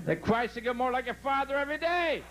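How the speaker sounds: acted motherly outburst; exasperated mother. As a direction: burst out in fed-up disbelief. Exasperated mother snaps at little boy.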